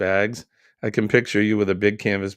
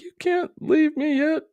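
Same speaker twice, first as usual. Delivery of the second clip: squeaky high mock plea from the boss; high-pitched imitation of a needy boss; imitating the boss. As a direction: play the boss whining in a high voice. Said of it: "He's like".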